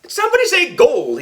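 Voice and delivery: high, loud eager question; gold-crazed eager cry; all excited. A narrator's reading. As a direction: burst in thrilled, pitch way up. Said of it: "he asked, all excited".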